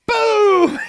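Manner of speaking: loud, high imitated blast; cannon boom. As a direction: shout the cannon shot as one big burst.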